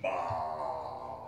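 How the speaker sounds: low, disgusted grunt; deep grumbling dismissal; scornful scoff. Acted. Scrooge has no better answer.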